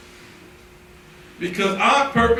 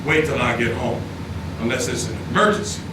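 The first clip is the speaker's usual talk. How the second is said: low, calm quoted warning to his wife; not hollering; 12 semitones lower, 5 dB quieter than usual. Retold or imitated. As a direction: tell her firmly without raising your voice. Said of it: "and I don't holler".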